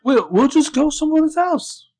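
high, slow hammed-up pleading; high mock Jordan. Podcaster playing Jordan begging Hannah in a high whine. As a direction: whine it like a lost puppy.

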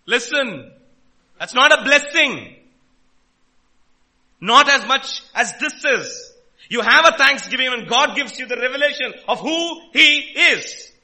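full-force preacher driving the point home; impassioned preaching. Real, not acted. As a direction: thunder it out to the congregation. Preacher bursts out to correct congregation.

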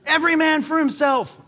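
hammed-up high panicked shouting; mock panic. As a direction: play Jesus as comically terrified, yelling orders. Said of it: "Jesus wakes up and says".

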